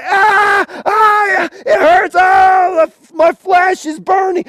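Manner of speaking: high, loud cries of pain; acted martyr screaming as he burns; agonized cries. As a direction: yelp and wail through the words. Martyr in agony being burned.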